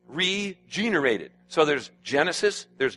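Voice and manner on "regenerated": "Regenerated" drawn out slowly, a little higher; slow, emphatic word. Shown as a demonstration. Preacher pointing out a key word, slow. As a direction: land "Regenerated" slow and deliberate. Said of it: "I've just emphasized some words".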